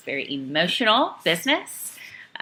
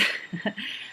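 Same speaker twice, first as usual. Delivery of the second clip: breathy laugh; 5 semitones higher, 11 dB quieter than usual. Real, not acted. Laughs at husband's wrong prediction.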